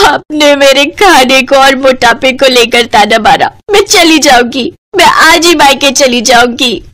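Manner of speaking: hammed-up wounded whine; offended tearful threat. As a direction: threaten to leave, whimpering theatrically. Offended wife threatens to leave.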